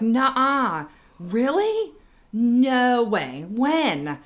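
put-on disbelief at phone gossip; feigned astonishment. Mom on staged phone call to fool Rich, astonished. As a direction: fake a juicy call to bait a listener.

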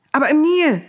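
high, loud call. A narrator's reading. Pharaoh calls after Moses as he leaves, calling out. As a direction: call after someone leaving. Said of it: "rief er".

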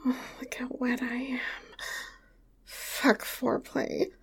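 urgent seductive whisper; hushed and hungry. Acted. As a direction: whisper it eagerly. Aroused whispered urging.

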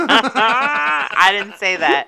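slow, loud laughing protest. Real, not acted. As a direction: protest while cracking up. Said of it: "I said it".

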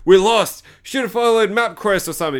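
high, loud start to a put-on complaint; mock complaining. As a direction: imitate a bickering lost traveller. Lost family member complains.

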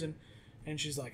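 ordinary delivery

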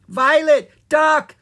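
high, loud warning yell. A narrator's reading. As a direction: shout a sudden warning. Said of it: "Sprite yelled".

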